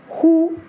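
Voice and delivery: "Hu" held high and level; first tone. Shown as a demonstration. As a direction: keep "Hu" on one steady high pitch. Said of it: "but have different tones".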